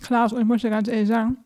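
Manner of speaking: talking with a mouth full of cake; words pushed round a bite of food. Real, not acted. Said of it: "Du sprichst auch noch mit vollem Mund".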